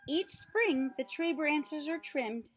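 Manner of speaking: usual speaking voice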